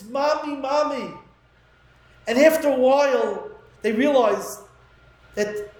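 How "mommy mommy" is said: retold child's cry. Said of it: "screaming through the hallways".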